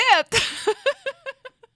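high, loud giddy laugh; shrieking laugh. Real, not acted. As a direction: burst into shrill laughter. Laughing at galette.

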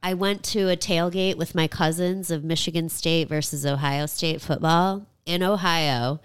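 usual speaking voice

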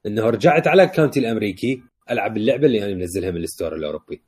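ordinary talk

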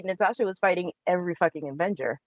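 ordinary talk, nothing marked

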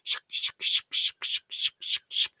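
breathy unvoiced shook shook rattle; whispered rattle sound. Shaman shaking a leaf rattle, whispered shook sounds. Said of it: "it makes a shook sound".

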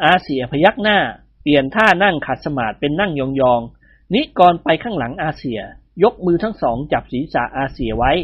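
usual speaking voice

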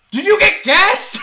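imitating the cashier shouting a question; calling out. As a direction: bellow it across the store, hammed up.

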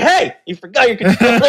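high, laughing fake-friendly call; laughing mockery. Clerk laughing as he returns the thrown controller. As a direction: laugh it out with sarcastic sweetness.

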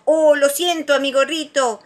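high, loud, sweet apology; apologetic Tiger. A narrator's reading. Tiger to friend knocked over, apologetic. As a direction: apologise brightly.

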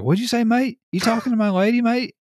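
hammed-up Aussie bloke squaring up, mate; imitating an Australian guy defending his fiance, high and loud; mock jealous Aussie. Voicing jealous Australian player.

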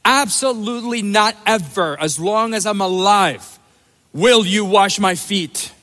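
Peter's adamant no, each word hit; emphatic refusal. Shown as a demonstration. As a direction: press every word as a flat no. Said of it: "he could not be more emphatic".

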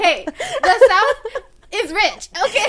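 loud, high, drawn-out protest through laughter; mock indignation. Southerner teasing back at Northern friend, mock indignation. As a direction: playfully defend your home turf, slowly and loudly.

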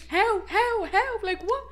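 high, loud, repeated disbelief; mock astonishment. Auntie shocked by surprise visit, high baffled cries. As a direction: imitate a baffled relative, pitched right up.

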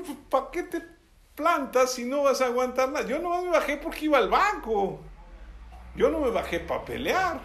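high, animated mock protest; retold indignant retort. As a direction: re-enact talking back to a driver. Retelling his retort to the driver.

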